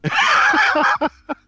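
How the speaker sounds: loud, very high burst of laughter; real high-pitched guffaw; incredulous laughter. Real, not acted. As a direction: crack up at the question. Asked whether he cried.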